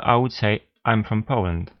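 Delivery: ordinary talk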